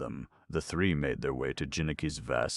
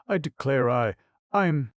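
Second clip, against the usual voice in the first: overcome with tears; 10 semitones higher, 7 dB louder than usual. Acted.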